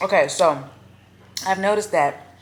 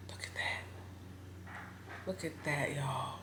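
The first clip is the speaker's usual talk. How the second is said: whispering; 18 dB quieter, slower than usual. Real, not acted. Showing food, whispered.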